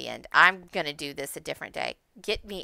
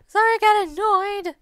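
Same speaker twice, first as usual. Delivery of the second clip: squeaky apology; 13 semitones higher, 9 dB louder, faster than usual. Real, not acted. Apologizing with outburst.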